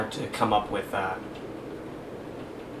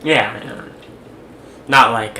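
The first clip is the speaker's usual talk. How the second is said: ordinary delivery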